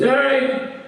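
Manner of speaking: put-on drunk Rob.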